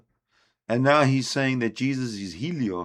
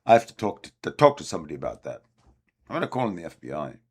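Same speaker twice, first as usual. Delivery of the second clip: quick, mocking imitation of the ranter; mocking impression; 6 semitones lower, much faster than usual. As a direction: rattle it off like his aside. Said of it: "he sticks in that little".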